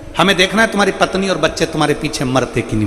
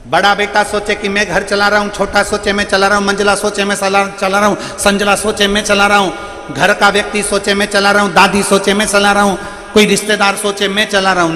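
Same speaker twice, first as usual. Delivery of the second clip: higher pitched preaching list; preaching build; 4 semitones higher than usual. Acted. Preacher mocks every family member's boast, higher pitched. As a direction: stack each claim in a lifted preaching voice.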